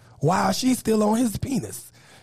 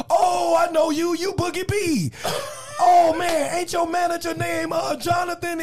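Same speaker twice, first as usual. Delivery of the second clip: excited recognition; 7 semitones higher than usual. Retold or imitated. The man in the car greets the comedian with excited recognition. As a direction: gush like you just spotted a celebrity.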